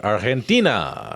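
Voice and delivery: put-on Spanish accent. Spanish pronunciation of Argentina.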